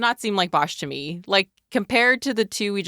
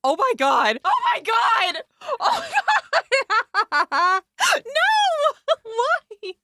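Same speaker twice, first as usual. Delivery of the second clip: high squealing oh my God; shocked laughter; much slower than usual. Real, not acted. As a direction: shriek with laughing disbelief. Shocked laughing at her picture.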